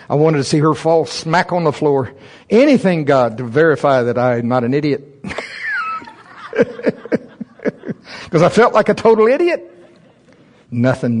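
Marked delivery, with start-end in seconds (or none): 5.3-6.1 high laugh. Real, not acted. Laughs at himself.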